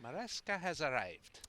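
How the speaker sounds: mock Scottish announcer; light Scottish lilt; Scottish accent. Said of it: "my Scottish accent".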